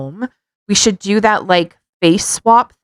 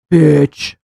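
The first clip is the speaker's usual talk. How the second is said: mock swear; 5 semitones lower, 4 dB louder than usual. Swearing on his behalf.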